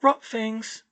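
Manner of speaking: airy, matter-of-fact; as if it explains everything. Acted. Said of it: "as if that explained everything".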